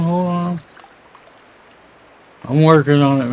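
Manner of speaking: ordinary talk, nothing marked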